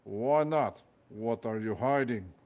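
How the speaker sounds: put-on suspicious guard in a Russian accent; guard accusing Elephant of hiding something, in a Russian accent. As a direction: press her in a Russian accent.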